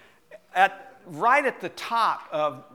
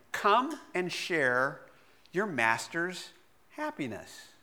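slow solemn quote; 4 dB quieter, slower than usual. Retold or imitated. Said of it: "the master says".

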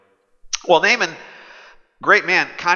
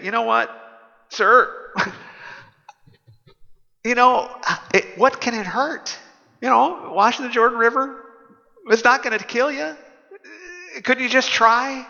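coaxing servants; 8 semitones higher than usual. Acted.

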